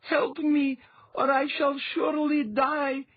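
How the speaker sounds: put-on high, loud pleading girl; crying out for help. A narrator's reading. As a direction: beg your father to save you. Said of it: "she cried out unto the watching Althalos".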